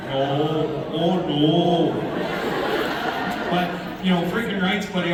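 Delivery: rowdy Canadian buddy accent; hammed-up Canadian accent. Shown as a demonstration. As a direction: talk like one of the brothers back in Canada. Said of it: "I like to do my original accent".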